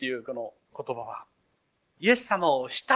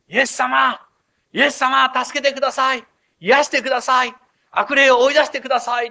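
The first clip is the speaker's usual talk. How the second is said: higher, louder pleading cries; crowd crying out; 9 semitones higher, 9 dB louder than usual. Retold or imitated. Crowd outside the house crying out to Jesus, loud pleading. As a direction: call out desperately for help. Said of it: "っていう叫びが".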